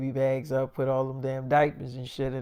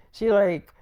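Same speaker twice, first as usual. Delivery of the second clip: usual speaking voice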